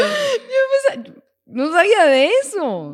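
lento, agudo, roto por la risa; hablando entre risas. Real, not acted. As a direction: intenta hablar sin poder parar de reír. (hablando entre risas, lenta y aguda)

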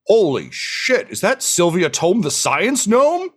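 high, drawn-out awed recognition; starstruck amazement. Acted. Masked guard recognizes a famous gnome, starstruck amazement. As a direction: draw it out in high-pitched astonishment.

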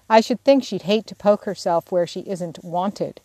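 usual speaking voice

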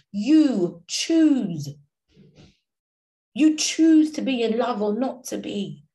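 slow, heavy refrain swelling to loud peaks; preaching. Acted. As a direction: preach it, building each line. Escalating motivational preaching refrain.

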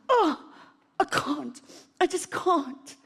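high, strained "I can't"; despairing cry. Retold or imitated. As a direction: cry out in defeat. Despair retold in sermon.

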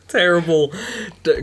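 ordinary talk

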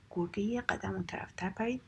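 ordinary delivery, nothing marked

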